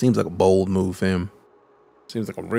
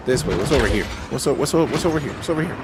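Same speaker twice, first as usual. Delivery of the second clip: ordinary talk, nothing marked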